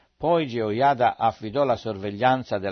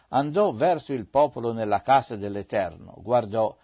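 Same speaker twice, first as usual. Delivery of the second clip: ordinary delivery, nothing marked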